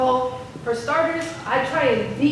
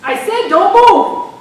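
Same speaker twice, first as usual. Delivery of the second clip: loud, high police commands; officer bellowing freeze; cop shouting orders; 7 semitones higher, 10 dB louder, slower than usual. Acted. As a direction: yell it at an armed man. Cop yelling again.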